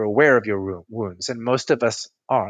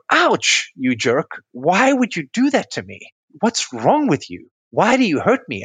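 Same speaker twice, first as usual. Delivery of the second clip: high yelp of ouch, then higher accusing questions; hurt angry outburst; 6 semitones higher than usual. Retold or imitated. Partner with broken toe blaming dance partner, high hurt accusations. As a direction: blame your partner for the pain. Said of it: "you're gonna go".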